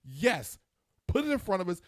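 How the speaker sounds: fed-up husband barking back; exasperated. Real, not acted. Husband ranting about dinner questions, loud and fed up.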